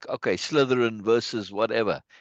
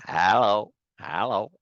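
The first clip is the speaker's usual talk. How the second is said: American "hello", open HAL-oh; American accent; 2 semitones lower, slower than usual. Shown as a demonstration. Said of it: "That's American. That's not English".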